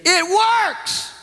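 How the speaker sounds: high, joyful shout; excited shout. Retold or imitated. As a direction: blurt it out high with delight. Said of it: "She said again".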